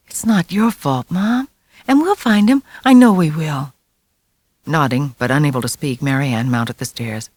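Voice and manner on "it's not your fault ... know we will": comforting. A narrator's reading. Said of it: "trying to offer her comfort".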